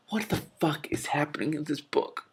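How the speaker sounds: unvoiced whisper, half to herself; baffled whisper. Real, not acted. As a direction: whisper your disbelief at the book. Reader's baffled aside.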